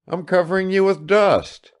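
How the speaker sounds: high, gaily voiced son. A narrator's reading. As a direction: protest brightly to your father. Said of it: "as he responded gaily to his father's greetings".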